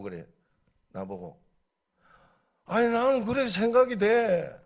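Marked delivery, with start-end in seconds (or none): 2.7-4.6 high, loud, stubborn reply; insistent retort. Retold or imitated. Pastor's own retort in dispute.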